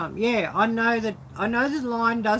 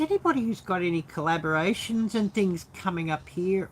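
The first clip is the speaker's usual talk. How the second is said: normal talking voice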